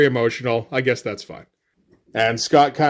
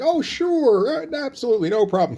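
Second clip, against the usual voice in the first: very high, sing-song acted agreement; mock eager agreeing; 9 semitones higher than usual. Madeline agrees to look after Lockheed, mock eager agreeing.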